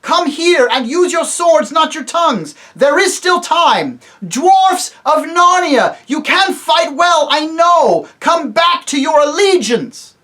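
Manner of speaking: very high, loud slow appeal; a king appealing to the dwarfs' loyalty; king pleading commandingly. Acted. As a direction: urge them back to his side. Tyrion pleading loudly with dwarfs.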